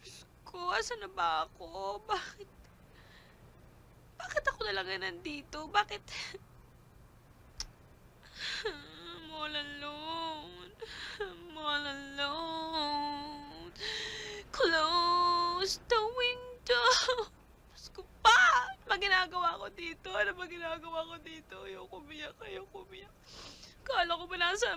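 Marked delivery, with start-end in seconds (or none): 0.0-6.0 crying softly, slow and broken; tearful. Acted. Lost woman alone, crying. 8.6-13.7 slow, soft, crying; tearful. Acted. Crying alone, repeated. 14.5-23.1 crying softly, slow and broken; tearful. Acted. Lost woman alone, crying.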